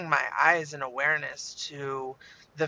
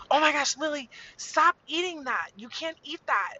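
put-on high-pitched kid scolding her sister; alarmed child; 11 semitones higher than usual. Sophia tells Lily off in the back seat, high alarmed child voice. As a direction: imitate a child urgently telling on a sibling. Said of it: "I hear Sophia go".

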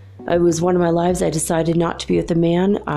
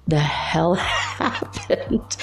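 laughing disbelief; 4 dB quieter, slower than usual. Real, not acted. Baffled recap, laughing mid-line.

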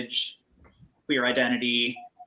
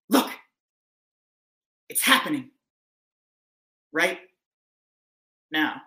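spoken word poem; 3 semitones higher than usual. Acted. Poem's final lines.

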